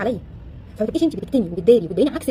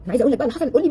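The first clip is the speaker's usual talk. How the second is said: ordinary talk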